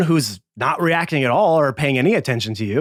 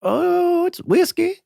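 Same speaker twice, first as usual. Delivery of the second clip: much higher, evasive squeak; high squeaky bartender; slower than usual. Acted. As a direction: squeak it out, caught in a lie. Bartender evasive about the drink.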